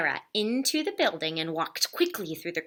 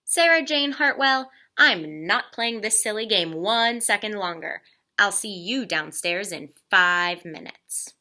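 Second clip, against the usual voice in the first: louder, firm, full-name scolding; stern father; 3 semitones higher, 5 dB louder than usual. Acted. Father losing patience with daughter, firm loud scolding. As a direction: lay down the law.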